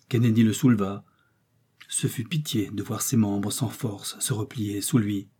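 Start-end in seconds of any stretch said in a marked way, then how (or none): none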